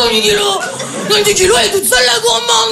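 high, shouted outrage; exploding vendor. Retold or imitated. Angry vendor yelling at a customer, high shout. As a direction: blow up like a furious market vendor. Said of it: "il a pété un câble".

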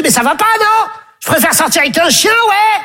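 high-pitched mock indignation; indignant rejection. Stéphanie turns down the suitor, high and indignant. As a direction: refuse him outright, pitch shooting up.

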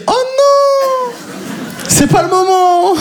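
high, slow "Oh non!" in mock panic; panicked inner voice. Stoned comedian panicking as the police officer speaks. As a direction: squeal it in panic. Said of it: "je me dis".

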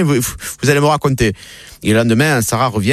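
ordinary talk

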